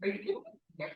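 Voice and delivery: high, soft incredulous disbelief; mock astonishment at the excuse; hushed, high are-you-kidding-me. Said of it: "I'm like".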